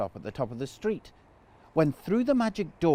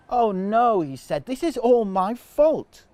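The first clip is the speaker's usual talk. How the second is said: dismay; 6 semitones higher, 5 dB louder than usual. A narrator's reading. Boy realises his mistake.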